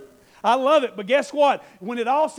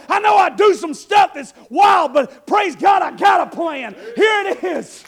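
much louder, higher exhortation; preaching shout; 5 semitones higher, 8 dB louder than usual. Acted. Preacher tells the church to declare the plan, shouting. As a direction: straighten up and declare it.

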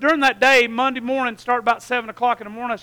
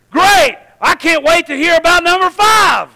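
loud, higher, thrilled reply; excited shout; 6 semitones higher, 7 dB louder than usual. Retold or imitated. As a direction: burst out with joy. Excited reply text read aloud.

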